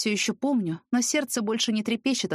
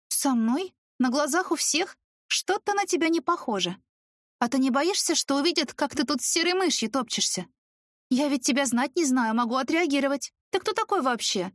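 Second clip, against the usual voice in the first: sarcastic; 7 semitones higher than usual. A narrator's reading. Heroine sarcastically confronts ex.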